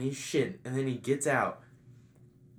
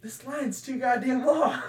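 high, slow mock complaint; shouting across party; 12 semitones higher, 4 dB louder than usual. Dickie complains about the bathroom line, mock shouting. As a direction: imitate hollering a gripe over a crowd. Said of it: "Why are they shouting across the party?".

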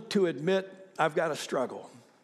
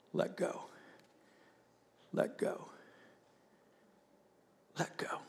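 hushed whisper with long pauses; God's gentle whisper; 9 dB quieter than usual. Retold or imitated. God to a believer at the brink, hushed whisper. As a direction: whisper it softly, letting each one settle. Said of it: "I hear him say".

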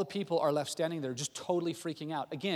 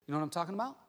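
ordinary talk

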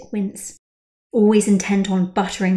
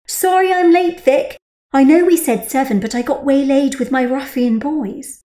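bright, higher friendly voice; cheery Rachel; 6 semitones higher, 5 dB louder than usual. A narrator's reading. Rachel apologising to her friend for being late, chirpy. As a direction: breeze in apologising.

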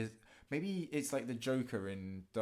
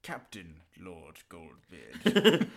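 grand proclamation; 4 semitones lower, 10 dB louder, much slower than usual. Pretend.

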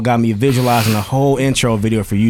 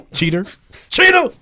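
shouting; 11 semitones higher than usual. Pretend. Mocking chant of cheater.

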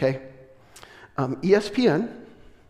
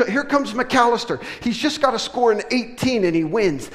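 excited; 6 semitones higher than usual. Retold or imitated. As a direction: tell it like the final throw is happening now. Reliving the tense final match.

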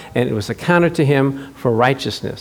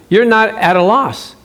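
ordinary delivery

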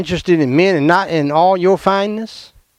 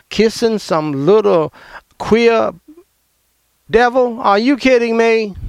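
disgusted outrage; 5 semitones higher than usual. Real, not acted. Preacher mocks Superman kiss.

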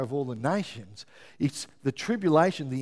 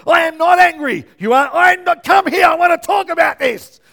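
shouted denial, much higher and louder; angry denial; 11 semitones higher, 13 dB louder than usual. Retold or imitated. Husband shouting at his wife that he's not angry, heated yell. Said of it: "retold row with Mel".

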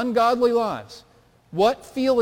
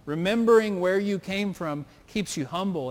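normal talking voice

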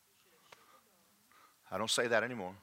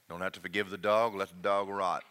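normal talking voice

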